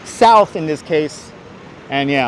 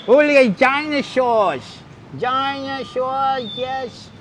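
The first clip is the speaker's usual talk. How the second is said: high, slow put-on dinosaur voice; drawn-out silly creature voice; 8 semitones higher, much slower than usual. Vlogger greeting the dinosaur display in a dinosaur voice.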